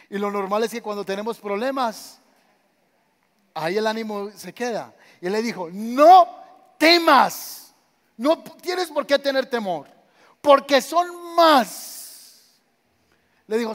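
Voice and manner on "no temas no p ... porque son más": loud, high, slow declaration; booming proclamation. Acted. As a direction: thunder it out to a frightened servant. Eliseo proclama con fuerza. (proclamación fuerte de Eliseo al criado)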